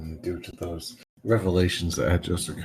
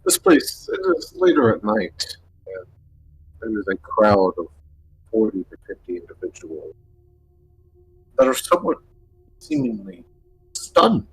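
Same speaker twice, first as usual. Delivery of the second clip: scene setting; 3 semitones higher than usual. Acted. Game master sets the scene.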